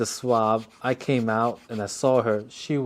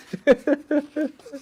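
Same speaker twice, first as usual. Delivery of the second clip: real laugh, much higher and louder; 6 dB louder than usual. Real, not acted. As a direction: crack up at the joke. Laughing at own punchline.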